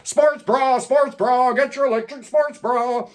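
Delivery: high, silly sung imitation; goofy lyric imitation. Reviewer goofs on the song lyric in a high silly voice. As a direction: ham up the lyric like a carnival barker.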